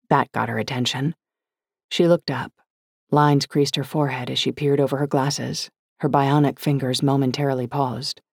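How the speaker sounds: plain reading voice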